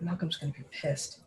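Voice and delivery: grumbling aside. Acted.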